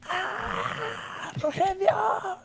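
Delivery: high, drawn-out wailing call of a name; panicked mom shrieking for her son, high and slow. Retold or imitated. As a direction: imitate a frightened mother crying out from the kitchen. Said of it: "she's like".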